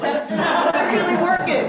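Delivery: loud, high, laughing admission. Real, not acted. Speaker laughing at her own failed affirmations. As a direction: laugh it out big.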